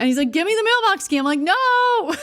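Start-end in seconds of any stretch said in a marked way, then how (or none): 0.3-1.2 high, louder playful demand for the key; half-laughing, insistent grab for the key; mock demand. Boyfriend playfully demanding the mailbox key, high mock insistence. 1.4-2.2 high, louder playful no; teasing refusal. Retold or imitated. Teasing the boyfriend over the key, high playful no.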